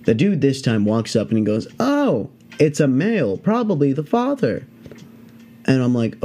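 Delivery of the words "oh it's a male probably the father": unbothered snake handler. Retold or imitated. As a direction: imitate a pro calmly noting a find.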